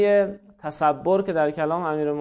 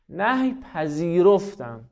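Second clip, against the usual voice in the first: normal talking voice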